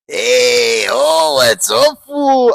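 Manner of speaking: loud, drawn-out Mexican accent. Shown as a demonstration. Podcast guest asked to do Mexican guy, mock accent. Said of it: "Do a Mexican guy".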